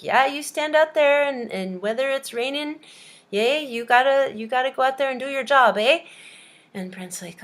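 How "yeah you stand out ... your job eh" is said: higher, louder hammed-up Canadian boss. Canadian guy interviewing Brent, hammed-up Canadian boss. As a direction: play a Canadian employer, punching each "eh". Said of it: "the, the Canadian's like".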